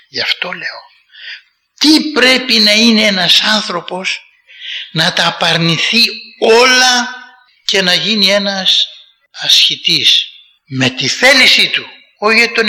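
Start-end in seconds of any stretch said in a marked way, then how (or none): none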